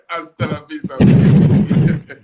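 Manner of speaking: put-on laughed through, loud and breathy on baby; laughing catchphrase. Laughing as he repeats the farewell to the manager. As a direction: crack up while saying it.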